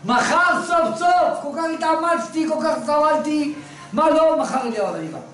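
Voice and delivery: can't wait for tomorrow, voice climbing; excited anticipation. Retold or imitated. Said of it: "הוא אומר".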